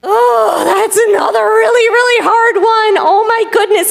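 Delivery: high-pitched, overwhelmed exclamation; dismayed little bird. Acted. Little bird reacts to hard word.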